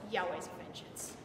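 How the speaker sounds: low, soft, dismissive echo; quiet scorn. Acted. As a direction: let the phrase drop with contempt. Scornful echo of the believers' cry.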